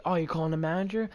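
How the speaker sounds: mock-indignant confrontation; retold taunt. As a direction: throw it back at him fast. Retelling his taunt in the row.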